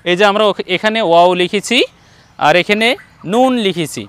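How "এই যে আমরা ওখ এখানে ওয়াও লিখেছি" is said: normal talking voice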